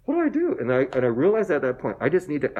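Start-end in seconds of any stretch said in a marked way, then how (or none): 0.0-0.6 much higher, a bit louder panicked cry; mock panic. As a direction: replay the panic attack, half joking. Panic attack at the temple.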